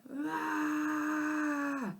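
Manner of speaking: long high cry of shock; shocked scream. A narrator's reading. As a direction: let out a long startled scream. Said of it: "It sounded kind of like".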